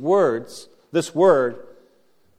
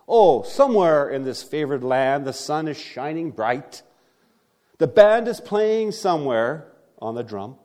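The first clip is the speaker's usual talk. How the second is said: theatrical poem reading, rising with the lines; poem recital; 4 dB louder than usual. Acted. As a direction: recite it like an old ballad to the congregation. Recites Casey at the Bat.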